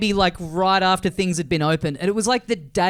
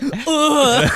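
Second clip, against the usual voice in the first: higher-pitched imitated "ugh"; mock groan; 6 semitones higher than usual. You're reacting to your own behaviour, mock groan. Acting out the listener's recoil.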